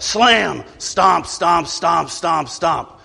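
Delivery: loud spoken slam, then stomps fading out; slam and stomping. Acted. Sulky child slams out and stomps off. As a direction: voice the door bang and footsteps dying away.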